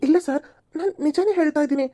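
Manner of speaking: anxious plea. Acted. Pleads he's truthful.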